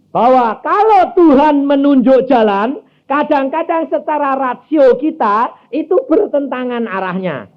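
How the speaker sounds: high, loud pulpit proclamation; full-force preaching. Acted. Preacher driving the point home to the church, loud preaching. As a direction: preach it out to the congregation.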